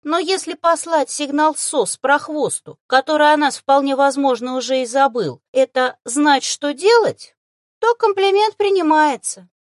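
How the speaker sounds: high, loud, wry creature voice; self-ironic retort. A narrator's reading. No longer angry Sian accepts the compliment, self-ironic. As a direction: accept praise by poking fun at yourself, high-voiced. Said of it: "самоиронично парировал уже не злящийся".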